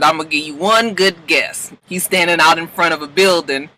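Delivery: ordinary talk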